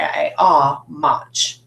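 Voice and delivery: "mach" with open "ah", like "motch"; broad A. Shown as a demonstration. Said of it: "its third or broad sound".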